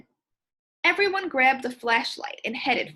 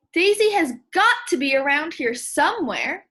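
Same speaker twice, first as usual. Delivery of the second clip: louder, anxious insistence; worried searcher; 4 semitones higher, 6 dB louder than usual. Shown as a demonstration. Boy reassuring friends about the lost cat, worried and louder. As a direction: insist she must be close by. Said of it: "sound like somebody who's looking for a lost pet".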